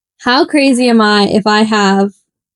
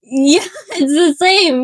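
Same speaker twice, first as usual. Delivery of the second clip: laughing; 6 semitones higher, slower than usual. Real, not acted. Laughing about notes search.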